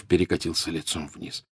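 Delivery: ordinary delivery, nothing marked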